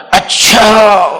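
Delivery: voiced, falling "اچھا" as gentle assent; calm, falling "اچھا" that accepts his point; calm acknowledgement. Shown as a demonstration. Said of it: "مولانا کا انداز ہوتا تھا".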